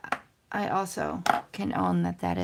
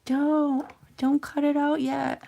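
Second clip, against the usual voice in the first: higher, urgent mock plea from a viewer; imagined viewer warning; 8 semitones higher, 5 dB louder, much slower than usual. Viewer begging the crafter not to cut yet, higher. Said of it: "were you saying to yourself".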